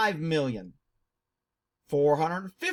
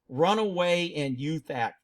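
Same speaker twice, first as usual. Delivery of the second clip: ordinary delivery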